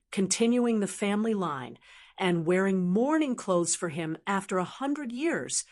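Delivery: ordinary delivery, nothing marked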